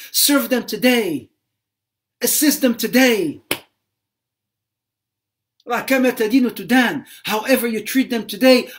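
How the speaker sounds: loud, forceful exhortation building in urgency; preaching at full force. Acted. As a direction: drive it home to the congregation, loud. Preacher exhorts children on parents.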